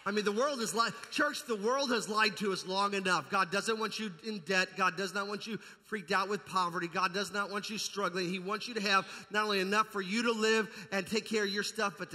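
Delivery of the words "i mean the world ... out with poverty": normal talking voice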